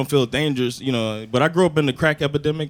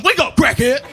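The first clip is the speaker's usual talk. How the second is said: high-pitched yelled wake-up order; hammed-up furious holler; mock angry shout; 9 semitones higher, 4 dB louder than usual. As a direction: act out a mad dad rousing a stranger. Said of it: "I got mad".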